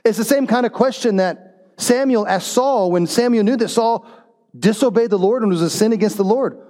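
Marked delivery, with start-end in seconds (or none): none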